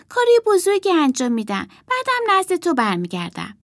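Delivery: put-on boy puppet voice. Puppet boy tells the girl he will prove himself, earnest. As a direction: voice the boy, proud and determined. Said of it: "گلوشو صاف کرد و گفت".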